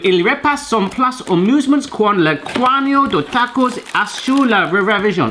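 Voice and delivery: slow, mock Spanishy Mexican accent. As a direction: ham up a Spanishy Mexican accent reading the box. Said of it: "I did it in, like, a Spanishy Mexican accent".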